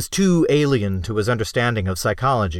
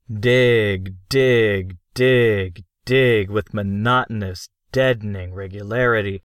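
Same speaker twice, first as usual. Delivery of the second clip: ordinary delivery, nothing marked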